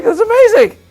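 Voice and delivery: very high, loud delighted squeal; giddy amazement. Retold or imitated. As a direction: gush with disbelief. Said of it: "I was like".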